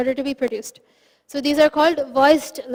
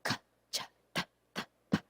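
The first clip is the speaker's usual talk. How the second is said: Ka, Cha, Ta, Ta breathed out with no voice; whispered syllables; 14 dB quieter, faster than usual. Shown as a demonstration. Teacher demonstrating voiceless sounds to the class, whispering. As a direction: whisper each syllable on air alone. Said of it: "try to say it without this voice".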